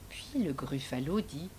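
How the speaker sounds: hushed storyteller whisper; whispered narration. A narrator's reading. Narrator whispering to children, hushed and low. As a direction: breathe the line like a secret.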